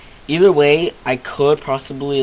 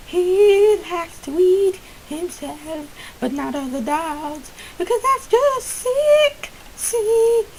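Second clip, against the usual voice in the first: very high, drawn-out mock disgust; silly high voice; slower than usual. Telling viewers about his dog, hammed-up very high disgust. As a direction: whine it like a scandalised cartoon.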